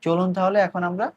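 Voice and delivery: usual speaking voice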